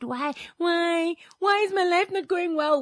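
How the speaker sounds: high-pitched plaintive "why"; acted bewildered questioning; questioning complaint. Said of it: "you ask questions, right?".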